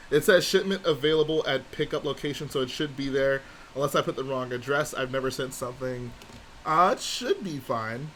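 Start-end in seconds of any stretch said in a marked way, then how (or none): none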